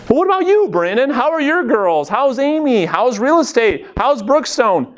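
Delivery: a lightly voiced friend asking about your family; friend asking back. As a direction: play the curious friend turning the questions back. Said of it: "She's gonna ask about me".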